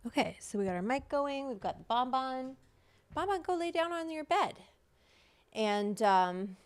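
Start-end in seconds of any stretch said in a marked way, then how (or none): 3.1-4.6 higher-pitched talking-to-a-pet voice; pet command. Real, not acted. Streamer sending Bon Bon to bed, pet command. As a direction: send the pet off, pitched up high.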